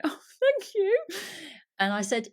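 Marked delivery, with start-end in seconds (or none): 0.0-1.0 imitating him: laugh, then a much higher, slow, bashful thank you; bashful delighted barista. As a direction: squeak out a shy, touched thank you with a giggle first. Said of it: "he stood there and went".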